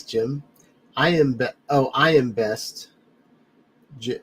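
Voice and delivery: usual speaking voice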